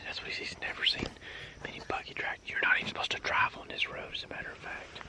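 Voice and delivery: hushed whisper in the woods; hunting whisper. Real, not acted. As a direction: whisper so the birds can't hear.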